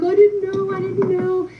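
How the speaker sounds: high whiny pleading; mock whiny boyfriend. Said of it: "he's like".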